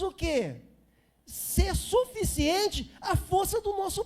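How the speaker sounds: fervent preaching. Acted. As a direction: preach it with mounting force, slow and weighty. Pastor preaching, rising intensity. (pregação fervorosa, mais alta e forte)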